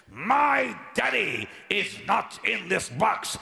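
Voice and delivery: loud, high and slow, every word hammered; shouted declaration. Retold or imitated. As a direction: proclaim it over the coffin. Pounding the casket at funeral.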